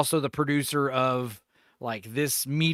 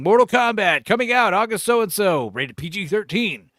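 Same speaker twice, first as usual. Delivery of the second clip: louder, higher trailer voice; mock trailer announcer; 6 semitones higher, 4 dB louder than usual. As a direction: hype it like a TV spot. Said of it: "the first one I saw".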